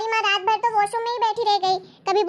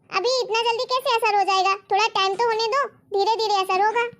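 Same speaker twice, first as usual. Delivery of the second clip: normal talking voice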